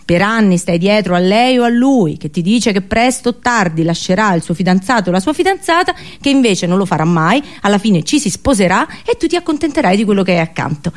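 usual speaking voice

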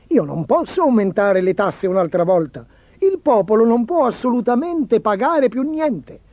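lightly acted desperate objection; protesting chancellor. A narrator's reading. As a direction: plead with mounting insistence, pitch climbing. Chancellor protests against new taxes.

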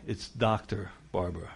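low, quiet and haughty; a stuffy, self-important put-down; snooty correction. Retold or imitated. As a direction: look down your nose at the greeter. Snobbish correction of a title.

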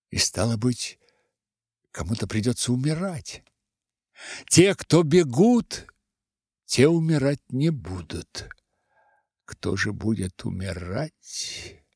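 plain reading voice

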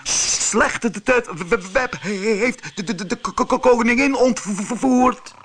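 high-pitched heavy stammer; high alarmed stutter, sounds repeated and held; stuttered cry. A narrator's reading. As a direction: cry out the news, stuck on consonants. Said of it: "Harro stotterde".

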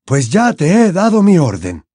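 put-on insistent old headmaster; Dumbledore, commanding. A narrator's reading. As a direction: give the order as final.

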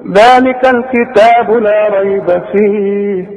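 slow measured chant with long held vowels; Quran recitation. Shown as a demonstration. Teacher replays what was read for students, Quranic chanting. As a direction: recite slowly, drawing out each verse ending. نسمع بقى اللي قريناه.

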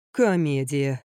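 low, resigned sigh of a word. A narrator's reading. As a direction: sigh it out wearily. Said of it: "вздохнул он". (со вздохом, низким голосом)

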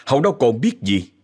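ordinary delivery, nothing marked

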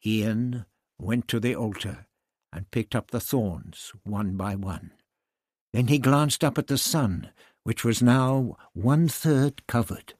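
usual speaking voice

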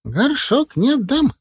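high, slow aghast granny; protesting old healer. A narrator's reading. Целительница защищает мамкин горшок, высоким протестующим голосом. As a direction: plead for the heirloom pot.

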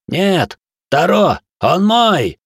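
high, loud desperate yell; desperate shout. Acted. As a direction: shout to stop him from afar. Prince shouts to stop hero.

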